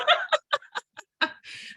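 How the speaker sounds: high, bright burst of real laughter. Real, not acted. Reacting to Chip's maskless joke.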